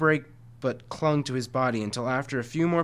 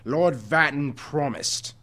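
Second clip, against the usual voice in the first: slow, eerie monster voice; a lightly voiced cursing creature; 2 semitones higher, 5 dB louder, slower than usual. A narrator's reading. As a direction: spit the promise out slowly. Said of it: "the Watcher cursed".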